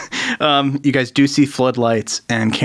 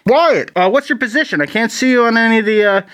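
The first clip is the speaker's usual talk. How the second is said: anxious boss checking in, pitched up; Tobias; 9 semitones higher than usual. Acted. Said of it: "the voice of Tobias".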